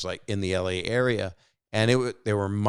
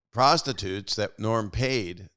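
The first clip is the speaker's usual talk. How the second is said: ordinary talk, nothing marked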